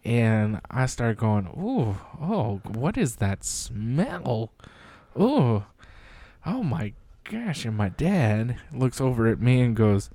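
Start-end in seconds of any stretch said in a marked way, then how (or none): 1.5-7.7 disgusted by smell. Retold or imitated. Retold reaction to awful smell.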